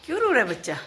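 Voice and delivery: high-pitched coo to a crying little one. Real, not acted. Owner comforts her whining dog, cooing high baby-talk.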